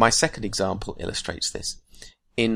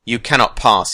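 louder, firm defiance; defiant; 7 dB louder than usual. A narrator's reading. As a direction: stand your ground, loud. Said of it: "he said".

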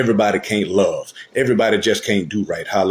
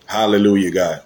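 normal talking voice

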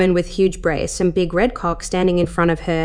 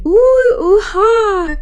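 very high, loud cries of pleasure; screamed climax; 6 dB louder, much slower than usual. A narrator's reading. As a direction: let out drawn, high-pitched wails. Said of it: "she screamed".

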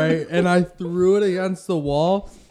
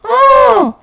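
high loud yelps; short shouts; 10 dB louder, much slower than usual. Real, not acted. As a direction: shout to test the echo. Testing the echo.

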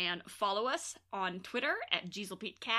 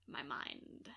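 whispered, softer and lower; mock whisper; 6 semitones lower, 9 dB quieter than usual. Podcaster jokingly whispers how she killed her friend. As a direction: whisper it like a dark secret.

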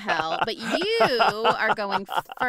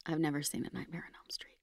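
whispered confession; 3 semitones lower, 9 dB quieter, much faster than usual. Real, not acted. Confessing, whispered full disclosure.

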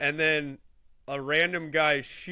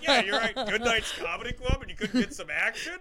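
teasing mom; 7 semitones higher, 3 dB louder than usual. Retold or imitated. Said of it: "She's like".

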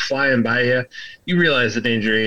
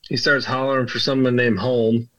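usual speaking voice

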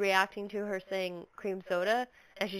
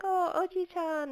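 cutesy; 9 semitones higher, slower than usual. Retold or imitated. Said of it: "She's like".

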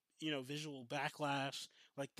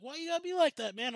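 high, loud whiny complaint; retold indignant plea; mock protest; 13 semitones higher, 9 dB louder than usual. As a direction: imitate an aggrieved partygoer, voice shooting up. Retold partygoers protest his threat.

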